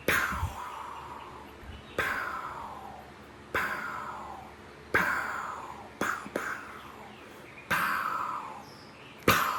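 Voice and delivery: soft, voiceless "pow" sounds with long gaps; imitated distant gunshots. Hunter mimicking shots heard from the hill, hushed echoing pows. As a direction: puff out faraway rifle cracks.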